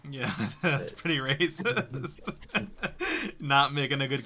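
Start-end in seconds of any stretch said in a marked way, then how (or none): 0.1-2.2 slow words broken by laughter; laughing. Real, not acted. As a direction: laugh through the line. Laughing agreement.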